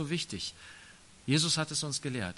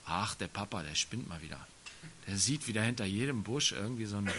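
softer, lower, brushing off Papa with a shrug; dismissive child; 5 semitones lower, 4 dB quieter than usual. Acted. A careless son waves off his worried father, dismissive and soft. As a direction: mumble it like a kid who thinks Dad is overreacting.

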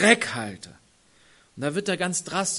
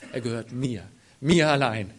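proud; 5 semitones lower than usual. Retold or imitated. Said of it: "voller Stolz".

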